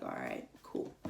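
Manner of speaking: breathy, almost unvoiced resigned reply; hushed. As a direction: let it out under the breath. Giving up after Nick's reply.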